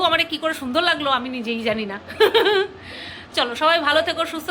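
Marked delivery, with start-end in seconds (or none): 2.0-2.7 light giggle; a short bright laugh. Real, not acted. Laughing at herself.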